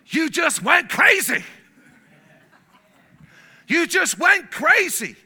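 usual speaking voice

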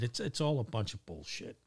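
ordinary talk

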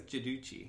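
"Giudice" said juh-DOO-chee; pronunciation. Shown as a demonstration. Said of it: "Is that how you say it?".

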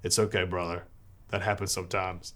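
soft, gentle reassurance; calm a shaken friend kindly; understanding. Retold or imitated. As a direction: priest softly comforting a tearful friend. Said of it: "real understanding".

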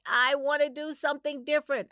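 inner scream. Retold or imitated. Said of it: "something else that's screaming inside".

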